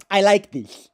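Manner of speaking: put-on high, loud lisped accent; Zizek. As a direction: declare it through a wet lisp. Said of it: "That was pretty good".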